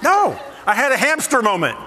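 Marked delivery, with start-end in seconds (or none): 0.0-0.4 very high, loud no; panicked refusal. Retold or imitated. Refusing the wife's plea to deal with the rat, high loud no. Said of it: "I'm like".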